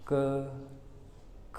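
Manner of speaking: क then ख, quiet and clear; letter sounds. Shown as a demonstration. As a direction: model क and ख for students. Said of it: "धीरे धीरे वायु कम होती जाएगी".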